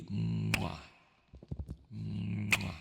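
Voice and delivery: drawn-out "mwah" kiss; kiss sound. Real, not acted. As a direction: blow a big smacking kiss. Blowing kisses to a viewer.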